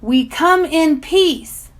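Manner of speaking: slow loud formal declaration; official. A narrator's reading. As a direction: announce it like an envoy. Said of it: "sounding more official than before".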